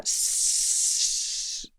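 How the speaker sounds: long soft hissing "sss"; drawn-out, voiceless hiss; imitated spurting hiss. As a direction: imitate the horrible noise of blood hissing out. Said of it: "there was this horrible noise, sort of like".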